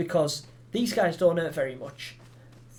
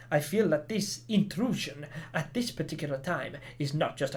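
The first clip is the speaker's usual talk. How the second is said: badly faked Spanish accent reading a village notice; attempted Spanish accent; 2 semitones lower than usual. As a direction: put on a clumsy Spanish accent. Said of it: "That accent was probably super offensive".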